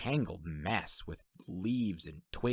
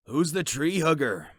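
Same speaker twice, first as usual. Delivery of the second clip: higher, louder sleepy Shirk; 8 semitones higher, 7 dB louder than usual. A narrator's reading. Shirk asks who the newcomer is, sleepily. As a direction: ask it as Shirk just woken. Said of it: "Shirk asked sleepily".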